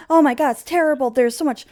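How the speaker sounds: high, loud, fast panicked rant; frantic screaming. Acted. Beatrice to her brother about the castle, frantic loud rant. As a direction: spill it all out in a frantic rush. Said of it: "starts screaming frantically".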